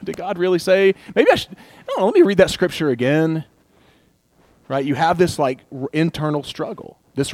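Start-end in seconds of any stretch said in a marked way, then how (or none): 0.0-3.4 higher, unsure voice talking himself round; wavering self-doubt. Acted. Preacher voicing a believer's doubt, higher and unsure. Acted inner voice of temptation.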